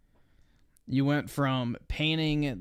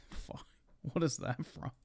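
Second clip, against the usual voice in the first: stunned under-breath whisper, softer; surprised whisper; 2 semitones higher, 10 dB quieter than usual. Real, not acted. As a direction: whisper it to yourself, caught off guard. Surprised by an ad clip.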